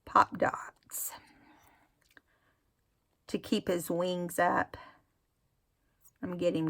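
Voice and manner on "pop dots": whispered correction. Real, not acted. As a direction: Pop dots whispered to self.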